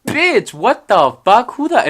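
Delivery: loud, high, drawn-out indignation; outraged outburst. Retold or imitated. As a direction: explode in disbelief, acting out the retort. Outraged inner reaction, acted.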